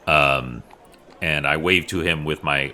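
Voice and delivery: ordinary talk, nothing marked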